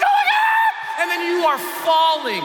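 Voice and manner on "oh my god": very high-pitched shriek of panic; acted top-of-the-lungs cry; terrified screaming. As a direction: scream it while plummeting. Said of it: "screaming at the top of my lungs".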